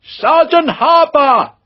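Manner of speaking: long hailing call across a plaza, high and loud. Acted. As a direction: shout through cupped hands for a lost sergeant. Officer calling lost sergeant.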